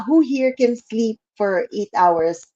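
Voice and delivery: normal talking voice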